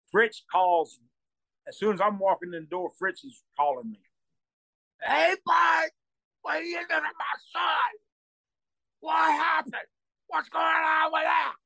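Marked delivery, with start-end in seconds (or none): 5.0-11.5 high shouting outrage at a son's beating; angry yelling boss. Retold or imitated. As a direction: yell it like an outraged father on the phone. Angry Fritz yelling on phone.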